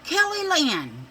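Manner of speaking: high, loud, slow scold of the full name; grandma calling out the full name in alarm. Retold or imitated. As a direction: call her out by both names, slowly. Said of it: "Barb's like".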